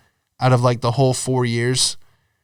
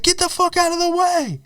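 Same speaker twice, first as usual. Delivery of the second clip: yelling cab driver. Retold or imitated. Cab driver blaming the cyclist he hit, high mock yell. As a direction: imitate an irate driver, pitched way up. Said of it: "starts yelling at the guy on the bike".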